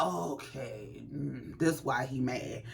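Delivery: hushed realization watching the clip; knowing low okay. Retold or imitated. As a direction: murmur it like it all makes sense. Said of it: "I was like".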